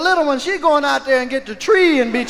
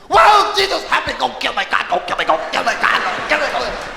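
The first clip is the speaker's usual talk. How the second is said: grandmother's wailing voice; faster than usual. Acted. Grandmother finding the cocaine, mock wailing yell. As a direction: imitate a hammed-up, repetitive holy wail. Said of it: "She did that voice".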